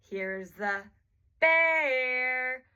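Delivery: "bear" stretched long, swooping up and then down; rise-fall on BEAR. Shown as a demonstration. Therapist changing intonation to highlight a word, "bear" long, up then down. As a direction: sing out "bear" high, then let it fall. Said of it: "goes up and then down".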